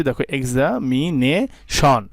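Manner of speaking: এক্সামিনেশন drawn out slowly in four parts; teacher splitting এক্সামিনেশন; slow syllable split. Shown as a demonstration. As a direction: sound out এক্সামিনেশন in four beats. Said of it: "চারটি ভাগে ভাগ করেছি".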